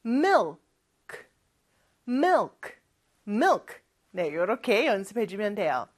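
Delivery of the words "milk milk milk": "milk" with dark L then a small released K; dark L, light K. Shown as a demonstration. Teacher models milk for learners, dark L and light K. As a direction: say "milk", holding the L, tapping a light K. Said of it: "크 살짝 해주는 거예요".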